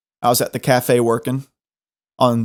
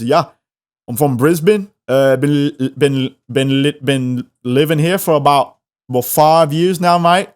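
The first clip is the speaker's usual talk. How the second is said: halting Aussie impression, stumbling over words; Australian accent. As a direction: imitate an Aussie searching for his words. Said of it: "This giant Aussie dude".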